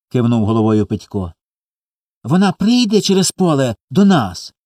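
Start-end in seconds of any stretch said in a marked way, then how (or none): 2.2-4.5 put-on high, wondering little-boy question; hopeful child. A narrator's reading. Small boy asks if mama will come, hopeful. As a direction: ask high and eagerly, like a child.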